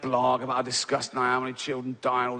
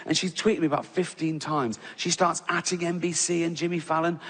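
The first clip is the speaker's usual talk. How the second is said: normal talking voice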